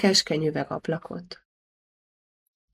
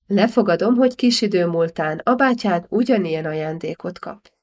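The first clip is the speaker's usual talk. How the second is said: ordinary delivery, nothing marked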